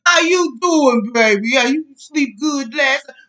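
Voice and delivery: smother them with sweetness; syrupy sweet greeting. Retold or imitated. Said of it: "You wear their ass that".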